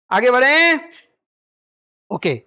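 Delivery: ordinary talk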